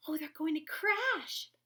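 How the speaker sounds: high, loud alarmed gasp. A narrator's reading. Stellaluna gasps seeing friends about to crash, alarmed. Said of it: "gasped Stellaluna".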